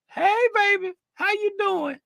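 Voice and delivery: high, soft cooing; tiny, high-pitched lovey voice; playful sweet-talk. Pretend. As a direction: coo flirtatiously to your sweetie. Sweet-talks sweetie in the chat.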